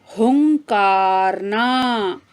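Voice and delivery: हुंकारना drawn out slowly, nasal हुं held; slow demo word. Shown as a demonstration. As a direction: model हुंकारना slowly for pupils. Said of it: "अगला शब्द है". (धीमे, खींचकर उच्चारण)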